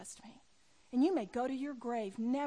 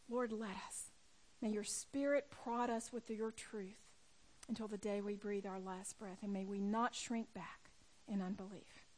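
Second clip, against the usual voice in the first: hushed, earnest prayer; closing prayer; 2 semitones lower, 4 dB quieter than usual. Real, not acted. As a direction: lead the room softly in prayer.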